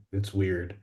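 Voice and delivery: ordinary delivery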